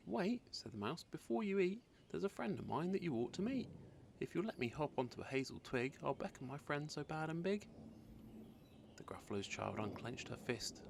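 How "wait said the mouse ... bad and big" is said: high, quick little mouse. Acted. Mouse stalling the Gruffalo's child, high pleading voice. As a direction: squeak it out urgently.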